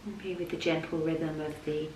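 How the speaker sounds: soft, level voice with long pauses; unhurried, hushed yoga nidra guidance; guided meditation. Real, not acted. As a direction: guide them gently down into rest.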